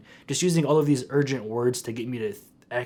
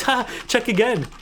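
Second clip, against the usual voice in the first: much higher, mocking gotcha voice; Syskey laughing taunt; 11 semitones higher, 4 dB louder than usual. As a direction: imitate a gloating UK troll. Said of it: "He starts laughing".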